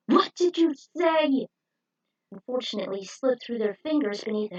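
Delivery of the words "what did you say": high, loud indignant villain; villain's outburst. Acted. Villain snapping back at the heroes, indignant shout. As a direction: snap back in disbelief.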